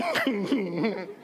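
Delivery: imitated helpless giggling; muffled inappropriate giggle; stifled giggle. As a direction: giggle as if you shouldn't be. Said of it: "I start giggling over here".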